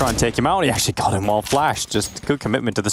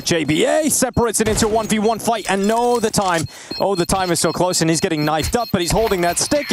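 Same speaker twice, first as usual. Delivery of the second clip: higher urgent live call; tense play-by-play; 9 semitones higher than usual. Acted. Caster calling the knife fight, higher and urgent. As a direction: ride the clock down.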